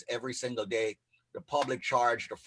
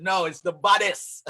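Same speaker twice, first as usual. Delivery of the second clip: put-on Jamaican accent; 5 semitones higher, 5 dB louder than usual. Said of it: "correct you and said".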